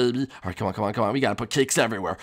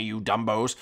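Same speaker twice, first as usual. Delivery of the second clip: usual speaking voice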